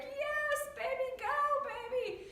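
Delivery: high-pitched proud-mom cheer; excited cheering. Retold or imitated. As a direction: cheer your child on, voice way up high. Mother cheering on her son.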